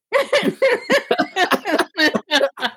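high, long burst of laughter; real laugh at her own note; big laugh. Real, not acted. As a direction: crack up at the absurdity.

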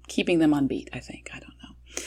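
hushed unvoiced aside; whisper. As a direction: whisper so the audience can't hear. Whispering to daughter during show.

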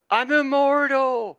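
imitated triumphant yell, high and drawn out; Rodney. Host recalls a teammate's yell on the video, imitated high victory cry. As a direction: mimic a victory cry, stretching it slowly up high. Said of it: "you hear Rodney yell".

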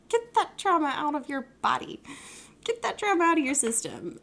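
high, loud mock exorcism; hammed-up imitation of partner; retold joking command. Partner's joking retort, both then giggled.

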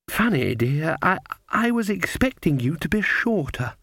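put-on a gentle old woman explaining patiently; kindly old grandmother. Grandmother remarks to Granny.

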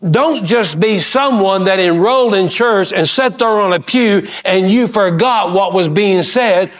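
ordinary talk

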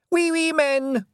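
put-on high, loud little boy's shout; a toddler yelling with delight; excited little boy calling out; small child shouting. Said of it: "shouted Wentworth".

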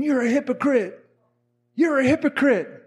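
imitated heckler, mildly heated; retold angry accusation. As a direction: call out the accusation like an angry stranger. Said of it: "this guy's just angry".

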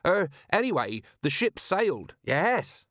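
high-pitched, hesitant bluffing; flustered excuse. A narrator's reading. Merchant bluffs the watch commander, flustered. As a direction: grab at an excuse and cling to it.

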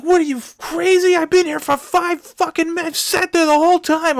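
high, loud mock yell at the other driver; retold screaming match. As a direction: yell it back up high like a furious stranger. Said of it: "we just start screaming at each other".

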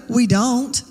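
ordinary delivery

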